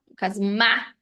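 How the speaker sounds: ordinary talk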